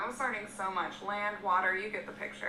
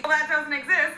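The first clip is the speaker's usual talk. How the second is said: dramatic reveal; 6 semitones higher, 9 dB louder than usual. Real, not acted. Punchline of globe story.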